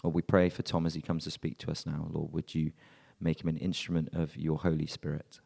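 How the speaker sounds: normal talking voice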